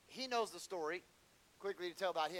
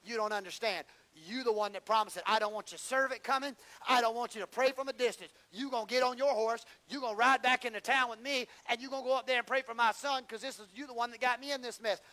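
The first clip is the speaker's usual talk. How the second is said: raised, insistent retelling of a mother's demand; defiant demand; 7 semitones higher, 10 dB louder than usual. Retold or imitated. Grieving mother insists the prophet come pray, loud and insistent. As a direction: press the prophet hard, louder and higher. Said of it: "She said".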